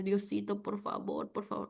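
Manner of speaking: soft, small-voiced begging; feeble pleading prayer. Preacher imitates aunt's poor prayer to God, feeble. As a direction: imitate a meek, weak little plea. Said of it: "una fe pobre, una oración pobre".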